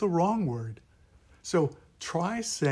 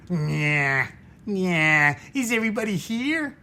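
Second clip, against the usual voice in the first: higher, fast nasal whine; nasal nyah voice; 4 semitones higher, 4 dB louder than usual. Shown as a demonstration. Teacher recapping a core sound, a fast nasal nyah voice. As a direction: whine it out fast through the nose. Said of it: "the five core sounds".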